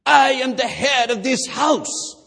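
loud, high shouted decree; furious Greek father. Retold or imitated. Father asserting authority over the suitor, loud shout. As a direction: bellow it like the boss of the family. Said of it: "his Greek accent".

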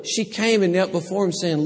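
normal talking voice

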